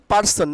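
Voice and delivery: "পারসন" said par-son with a sounded r; the wrong "পারসন", plain p and clear r; common mispronunciation. Shown as a demonstration. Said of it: "আমরা কি বলি?".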